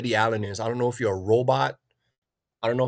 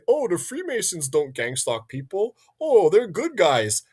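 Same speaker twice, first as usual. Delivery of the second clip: mock naive voice; 4 semitones higher than usual. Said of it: "if people say".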